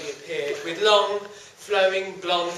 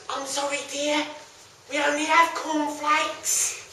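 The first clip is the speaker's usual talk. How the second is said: put-on old lady voice; 6 semitones higher than usual. Frail old lady answers.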